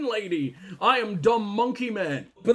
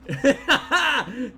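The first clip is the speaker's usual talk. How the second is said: loud burst of laughter; delighted laugh; 4 semitones higher, 3 dB louder than usual. Real, not acted. Laughs at finding the bunker.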